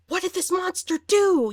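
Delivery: high, loud mock outrage; imitating mad officer. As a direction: cry it out in acted horror and anger. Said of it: "she's getting all mad".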